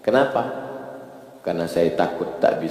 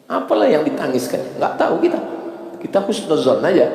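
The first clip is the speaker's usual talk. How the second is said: fervent preaching; 10 semitones higher than usual. Acted. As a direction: press the congregation with each question. Preacher questions why people weep.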